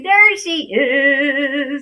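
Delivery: high, loud, long-held cheer; excited reveal. Real, not acted. Host greeting guest's appearance, drawn-out excited cry. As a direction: stretch it out with delight.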